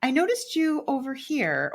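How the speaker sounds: imitating nosy people asking about her address; nosy acquaintances. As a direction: lightly play the busybody asking questions. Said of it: "they were like".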